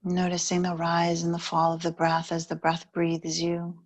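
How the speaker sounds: calm meditation guide, unhurried with long pauses; guided meditation. Real, not acted. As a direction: ease the listener into stillness, evenly and gently.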